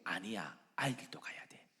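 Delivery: firm but whispered no; quiet refusal. Acted. Moses refusing the second offer, whispered. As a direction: say no under your breath.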